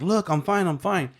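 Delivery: calm the crying mom down; reassuring mom. Retold or imitated. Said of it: "I'm like".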